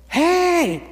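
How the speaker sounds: high, far-carrying shout; calling out. Retold or imitated. A man hailing someone across a crowded retreat, high shouted call. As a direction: holler over a crowd to get attention.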